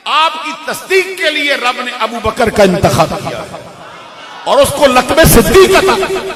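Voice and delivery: loud, high, impassioned sermon delivery; preaching at full force. Acted. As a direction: thunder it out to the congregation, drawing out key words.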